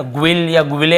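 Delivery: ग्विल, ग्विले with a W glide after G; mispronounced with U. Shown as a demonstration. Teacher shows how गाइल is not read, U wrongly sounded. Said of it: "ऐसे नहीं पढ़ रहे हैं".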